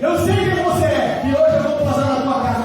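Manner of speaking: loud, half-sung climax of a sermon; full-force preaching. Acted. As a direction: preach it out over the music to the crowd.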